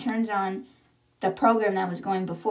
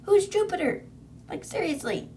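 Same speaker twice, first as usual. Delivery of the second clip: higher, squeaky real disbelief; baffled; 10 semitones higher than usual. Real, not acted. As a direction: ask it like you can't believe it. Reader's own baffled question.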